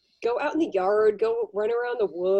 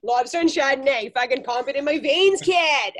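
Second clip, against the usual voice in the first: hammed-up Boston accent, building louder; old-timey Boston accent; 3 semitones higher, 5 dB louder than usual. Shopper craving lobster and Chardonnay, playful Boston accent. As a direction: lay the Boston accent on thick. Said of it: "the old timey Boston accent".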